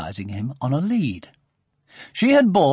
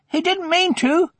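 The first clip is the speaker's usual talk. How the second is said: very high-pitched protest; high, urgent defence of a friend; boy's cry. A narrator's reading. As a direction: cry it out in a child's voice. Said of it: "cried his opponent".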